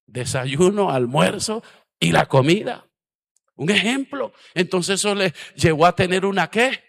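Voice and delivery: loud, high emphatic preaching; full-force preaching. Real, not acted. Pastor preaching to the congregation, loud and high. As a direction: drive the point home to the church.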